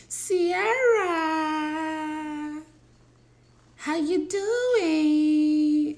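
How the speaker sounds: high, very slow drawn-out greeting; high, slow teasing impression of a viewer; mimicking Sierra. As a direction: stretch the greeting like a gushing fan. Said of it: "That's how you used to talk".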